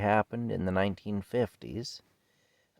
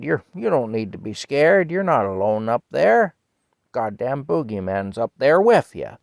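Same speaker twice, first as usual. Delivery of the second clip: loud, raised retold holler; old man hollering; 4 semitones higher, 9 dB louder than usual. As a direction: call up as the old farmer, retold. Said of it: "he said".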